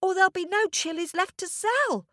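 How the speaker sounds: put-on high, fast hurrying child; urgent boy. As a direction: urge her on quickly. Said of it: "said the boy".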